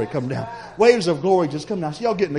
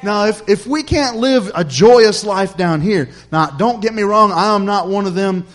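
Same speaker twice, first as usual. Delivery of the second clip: higher, driving sermon delivery; earnest preaching; 4 semitones higher, 4 dB louder than usual. Real, not acted. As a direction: preach it with rising conviction. Preaches the point home after story.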